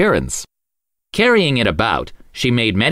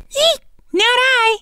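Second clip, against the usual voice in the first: very high, slow squeaky rat; squeaking rat; slower than usual. A narrator's reading. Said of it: "with a squeak".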